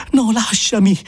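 a high cry of protest. Acted. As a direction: pull free with a cry. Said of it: "ella gridò".

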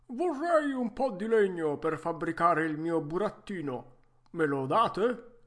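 vecchietto arzillo, voce forte e più alta; Geppetto arzillo. Acted. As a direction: saluta forte, da vecchietto pimpante. (vecchietto arzillo a voce alta)

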